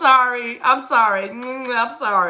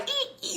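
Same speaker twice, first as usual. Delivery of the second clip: tiny, very high, soft squeaks; imitated bird squeak; 13 semitones higher, 13 dB quieter than usual. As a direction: peep like a small pecking bird. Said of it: "the bird kept like".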